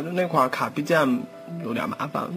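ordinary talk

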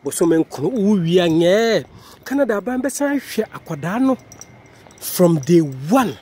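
usual speaking voice

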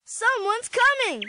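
high, excited call; excited cry. A narrator's reading. Arthur calling friends from the window, high and excited. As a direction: call out the news, thrilled. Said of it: "he cried".

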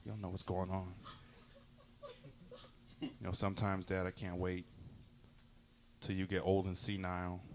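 low, soft aside to dad; muttered grudge. Acted. Son privately plotting for when dad is old, low and quiet. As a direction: drop right down, quietly biding your time.

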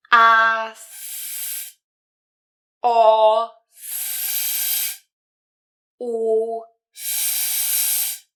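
"A, s, o, s, u, s" as held vowels, each followed by a long hissed s; slow separate sounds "A, s, o, s, u, s" with sustained airy s; syllable pronunciation demo. Shown as a demonstration. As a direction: draw out each vowel, then blow a long steady "s". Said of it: "jak dziecko ma wymawiać sylaby".

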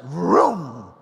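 loud, drawn-out vroom; big car-starting noise; imitated engine roar. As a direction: mimic an engine roaring into life. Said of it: "It just went".

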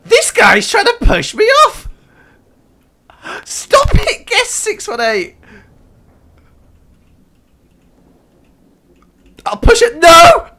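high, loud protesting shout; angry shriek. Real, not acted. Player being pushed off the pole shrieks at him.

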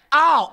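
loud high "ow"; acted pained yelp. Comic re-enacts getting head-butted by dog, loud pained cry. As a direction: yelp as if just struck. Re-enacting being hit in eye.

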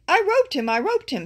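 high, loud boast, easing on the repeat; excited cowboy. A narrator's reading. Cowboy announces his catch, excited and high. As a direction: crow over the catch, starting high and loud. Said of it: "said one cowboy".

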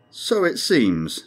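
very slow, dry understatement. A narrator's reading. Crewman dryly agreeing with his captain, slow. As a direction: let it drop slowly, deadpan. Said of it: "said Morford dryly".

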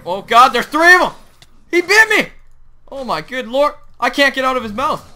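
high loud panicked cries; being chased. Real, not acted. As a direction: yell in panic under attack. Attacked by crocodiles.

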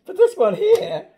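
high, loud hype; big emphatic boast, voice leaping up; excited. Real, not acted. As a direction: talk it up loudly. Hyping the longer track list.

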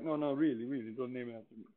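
a hint of Italian accent, soft and modest; mock Italian accent. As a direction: Torricelli waving off the honour in a light Italian accent. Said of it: "I don't know if that's an Italian accent or not".

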